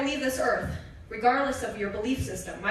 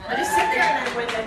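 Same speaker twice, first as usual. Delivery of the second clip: laughing through the words, louder and higher; laughing, winded; 5 semitones higher, 6 dB louder than usual. Retold or imitated. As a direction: laugh it out as if out of breath. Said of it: "so winded".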